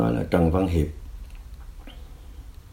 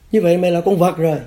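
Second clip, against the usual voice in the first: imitated scolding, high and louder; contemptuous interrogator; 8 semitones higher, 5 dB louder than usual. Cadre degrading the prisoner, mock angry. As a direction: hurl it as an insult, acted.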